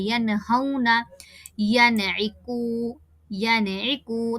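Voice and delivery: यनहौना and यनइकू, slow, नून sounded out; clear noon. Shown as a demonstration. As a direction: recite यनइकू slowly with a distinct नून. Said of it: "साफ-साफ पढ़ना है". (नून साफ़-साफ़ ज़ाहिर करके पढ़ना)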